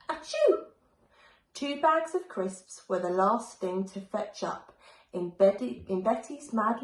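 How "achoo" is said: a high, sudden comic sneeze; big sneeze. A narrator's reading. Little witch Betty sneezing from the pepper, high sneeze. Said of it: "which made Betty sneeze".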